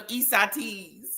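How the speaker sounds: Isatis said as "ee-sah-TEEZ"; naive mispronunciation. Retold or imitated. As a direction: say Isatis with a long "teez" ending. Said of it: "And I would call it".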